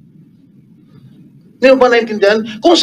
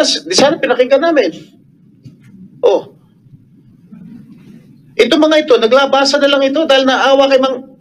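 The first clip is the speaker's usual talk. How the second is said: heated, much higher-pitched scolding; real anger as he confronts the witness; raised angry voice; 5 semitones higher than usual. Real, not acted. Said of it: "I'm sorry for, uh, raising my voice". (galit na pagtaas ng boses)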